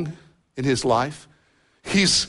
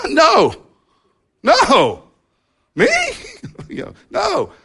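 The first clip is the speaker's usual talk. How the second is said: defensive denial; 11 semitones higher than usual. Retold or imitated. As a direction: yelp it out like a caught husband. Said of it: "my first default response".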